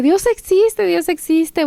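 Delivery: high, repeated joyful cry, mildly shouted; grito gozoso. Retold or imitated. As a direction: proclaim it high-pitched, imitating your shouting. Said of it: "empiezo a gritar". (grito agudo y alegre, a media fuerza)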